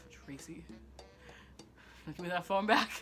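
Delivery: hushed name, long pause, then a firmer demand; imitating contained fury, very soft at first; quiet menace. Angry teen threatening cousin over the phone, quiet menace. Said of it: "you could see it in my face".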